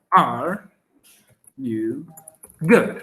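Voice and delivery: each word spaced out. Shown as a demonstration. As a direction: break the question into single words. (palabra por palabra, con pausas)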